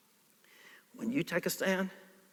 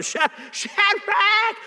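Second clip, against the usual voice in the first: king shouting into the flaming furnace; 7 semitones higher than usual. A narrator's reading. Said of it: "and he shouted".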